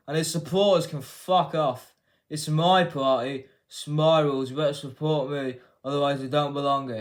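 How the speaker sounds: loud, elongated mock political declaration; mock politician, loud and emphatic; Keir Starmer. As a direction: lay down the law with stretched-out words. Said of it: "said that in an elongated".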